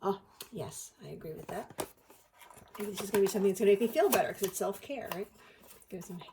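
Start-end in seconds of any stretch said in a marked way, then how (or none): none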